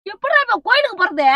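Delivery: loud, high-pitched complaint; exasperated scolding. Acted. Mother asking how to reach the temple now, exasperated. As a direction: throw the question at him angrily.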